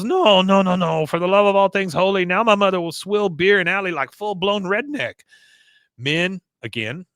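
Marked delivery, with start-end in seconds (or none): 0.0-5.2 horrified son. Retold or imitated. Son horrified watching his mother.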